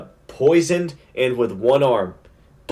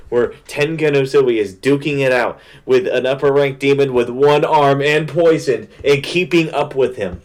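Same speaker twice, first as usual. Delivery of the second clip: ordinary delivery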